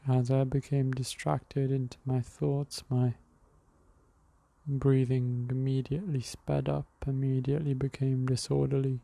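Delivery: calm breathing guide talking the listener through; guided meditation. Acted. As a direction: ease the listener into the breath, unhurried.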